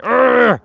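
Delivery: loud high frustrated "Urgh"; frustrated yell of rage alone in the car; raging growl. Retold or imitated. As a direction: let out a furious grunt of rage. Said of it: "in a rage".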